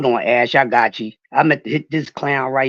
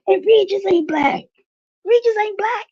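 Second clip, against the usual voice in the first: much higher, laughing emphasis; amused exclamation; 5 dB louder than usual. Real, not acted. Telling Ash the joke, high gleeful repeat. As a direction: crow it out gleefully. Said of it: "repeated, much higher".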